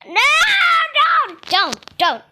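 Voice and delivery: high, loud repeated don't; pleading shout. Real, not acted. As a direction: cry out to stop them. Begging troll to stop.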